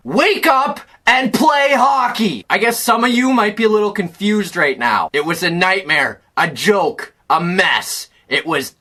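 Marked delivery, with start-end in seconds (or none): 0.1-2.5 slow, raised scolding shout; fed-up fan's drawn-out command; exasperated order. Real, not acted. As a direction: hammer out each word to a lazy team.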